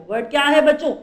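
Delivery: usual speaking voice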